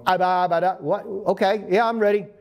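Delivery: stumble, then hastily agree; mock caught-off-guard rookie; flustered stammer.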